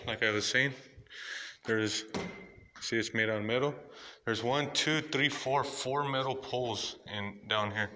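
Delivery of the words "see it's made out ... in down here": ordinary talk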